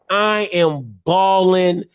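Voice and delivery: normal talking voice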